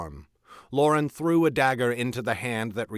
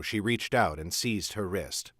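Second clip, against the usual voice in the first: plain reading voice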